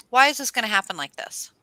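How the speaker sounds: exasperated question. Acted. Asking why it all goes wrong, loudly exasperated. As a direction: demand an answer, pushing it out. Acting out dismay at going wrong.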